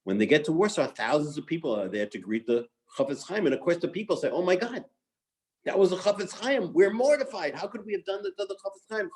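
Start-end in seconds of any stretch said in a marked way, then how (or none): 4.3-9.1 higher-pitched retold dismay; imitating an aghast "oh no"; mortified crowd. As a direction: voice townspeople horrified at their own mistake. Retold crowd realizing its terrible mistake.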